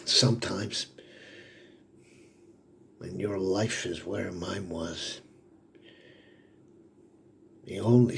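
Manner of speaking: softly, with long heavy pauses; subdued confession. Acted. Father confiding his last hope for his kids, subdued. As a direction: confide it quietly, haltingly.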